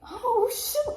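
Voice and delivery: high squeal of joy; excited exclaim. Retold or imitated. Realizing God answered her prayer, high and excited. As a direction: let it fly up in delight. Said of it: "So I'm like".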